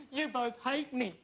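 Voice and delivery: usual speaking voice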